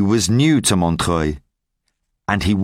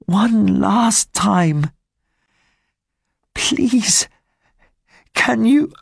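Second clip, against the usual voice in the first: weak dying woman; 10 semitones higher than usual. Acted. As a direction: plead faintly from a sickbed. Dying Fantine asks to see daughter.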